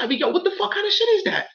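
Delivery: high, fast disbelieving rant; incredulous outrage. Real, not acted. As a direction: pitch flies up in disgust. Outraged rhetorical question.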